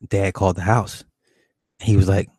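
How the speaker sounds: normal talking voice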